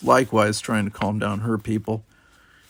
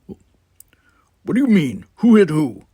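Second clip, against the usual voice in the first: startled demand; 7 semitones higher, 10 dB louder, slower than usual. Acted.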